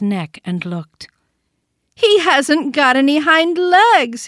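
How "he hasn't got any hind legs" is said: higher, louder and slow taunting call. A narrator's reading. Wild rabbit calling out to mock the toy rabbit, loud and slow. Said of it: "he called out".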